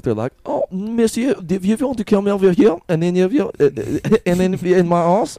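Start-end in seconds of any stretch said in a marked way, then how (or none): 0.4-2.8 high, energetic mock Frenchman; camp French accent. Podcaster mocking how French people sound, high camp French accent. 2.9-5.4 high, giggly mock French accent; camp French accent. Podcaster losing it mid-riff, high camp French accent.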